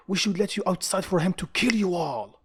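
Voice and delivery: high-pitched, indignant narrated outburst; angry shout. A narrator's reading. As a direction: shout it like an outraged officer. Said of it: "one of them shout".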